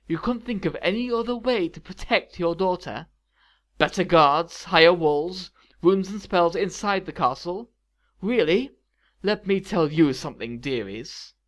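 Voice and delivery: furious, uncontrolled. A narrator's reading. Maleficent rebukes the imprisoned parents for failing their daughter, furious. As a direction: berate them, hammering each alternative. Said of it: "this furious".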